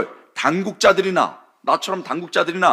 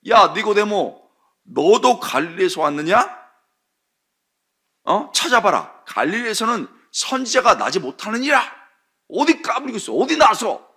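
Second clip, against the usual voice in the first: higher, louder angry scolding; angry rebuke; 6 semitones higher, 4 dB louder than usual. Retold or imitated. Council shouting down Nicodemus, angry rebuke. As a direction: put him in his place.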